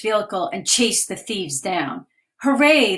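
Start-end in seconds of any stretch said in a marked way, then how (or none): 2.4-3.0 bright loud hooray, louder; cheer. Acted. Girls cheering as the officer cuffs the thief, loud cheer. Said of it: "the girls cheered".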